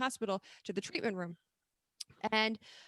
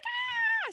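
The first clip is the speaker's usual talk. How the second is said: very high squeal of joy; delighted squeal. Retold or imitated. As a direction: squeal like a happy kid. Kid's delighted reaction.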